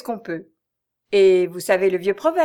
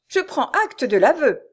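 plain reading voice

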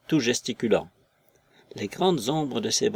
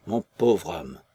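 lower, sinking "mon pauvre homme" as the wail dies; widow's lament trailing off; fading lament; 5 semitones lower, slower than usual. A narrator's reading. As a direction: let the grief drop away low. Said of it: "secouée de sanglots".

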